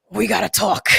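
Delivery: half-joking imitation of Batman saying we need to talk. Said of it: "kinda like a Batman voice".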